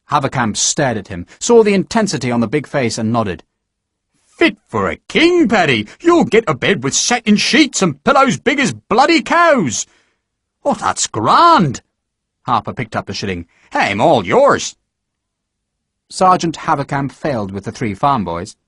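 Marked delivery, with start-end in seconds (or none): 4.2-9.9 high, hearty sales pitch; a jovial sergeant promising luxury; boastful sergeant. Acted. Sergeant selling army life. 10.6-14.8 slow, pleased Irish drawl; a contented Irishman taking the coin. Acted. Irishman accepting shilling.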